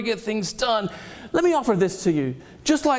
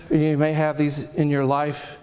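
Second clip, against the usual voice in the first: ordinary talk, nothing marked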